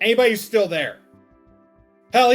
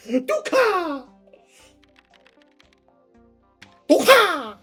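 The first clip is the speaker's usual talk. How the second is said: excited shout; 10 semitones higher, 7 dB louder, much slower than usual. Real, not acted. Calling out excitedly.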